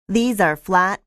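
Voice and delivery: ordinary talk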